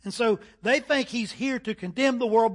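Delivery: ordinary delivery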